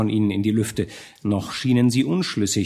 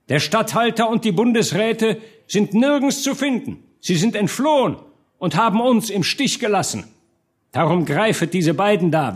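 high, emphatic orator's voice; rally the crowd, building to a final cry; rallying speech; 8 semitones higher than usual. A narrator's reading. Hartmann leads burghers to open the gate, rallying speech.

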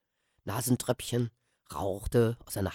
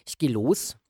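usual speaking voice

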